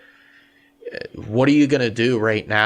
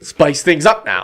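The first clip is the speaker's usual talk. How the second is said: loud, higher, drawn-out hype; hyped up; 5 semitones higher, 8 dB louder than usual. Real, not acted. Cohost kicks off talk about the rut, hyped up. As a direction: crank up the energy, stretching the words.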